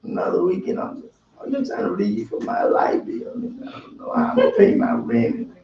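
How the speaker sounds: hushed whiny voice; a hammed-up whining prayer; mock whine. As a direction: whine it under your breath to God. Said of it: "it's a whine".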